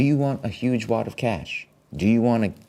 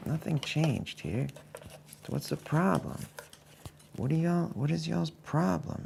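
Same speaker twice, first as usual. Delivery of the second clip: frustrated whine; 8 semitones higher, 6 dB quieter than usual. Real, not acted. Venting at broken camera.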